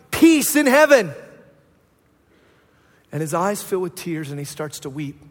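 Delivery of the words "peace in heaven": loud high ringing cry; loud festive crowd cry; crowd shout. Retold or imitated. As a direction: call it out like the crowd.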